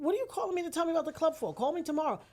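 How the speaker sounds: imitating an impatient sister on the phone; brushing-off sister. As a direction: rattle off an annoyed brush-off. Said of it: "She's like".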